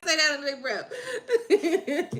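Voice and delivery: high, loud tearful laughing; laughing through tears. Real, not acted. Streamer joking about her crocodile tears, laughing through tears. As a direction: really laugh while still crying.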